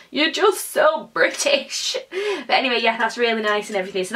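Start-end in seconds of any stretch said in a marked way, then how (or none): 0.1-2.0 slow, higher mock Italian voice; mock Italian accent. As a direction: drag it out like a tutting Italian. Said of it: "and going".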